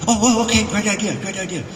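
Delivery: high, over-keen yes-man reply; hammed-up eagerness; eager agreement. Going along with director's idea.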